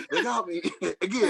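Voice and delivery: high-pitched words breaking into laughter; laughing. Real, not acted. Friend admitting they got him again, laughing. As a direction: laugh helplessly through the words.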